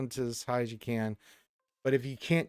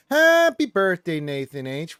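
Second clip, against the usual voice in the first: high, loud, slowed birthday call; a bright hammed-up happy birthday; excited greeting; 4 semitones higher, 9 dB louder, slower than usual. Real, not acted. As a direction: sing out the birthday wish.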